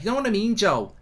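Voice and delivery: a high Scouse accent put on; Scouse impression. Mimicking a Scouser to Joe, high Scouse accent. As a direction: slide into a Liverpool accent again. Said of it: "that's sort of how I do my Scouser".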